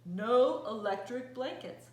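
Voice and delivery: loud angry refusal; loud, stubborn no; angry farmer. Acted. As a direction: bark the refusal at the cows.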